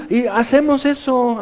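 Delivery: ordinary delivery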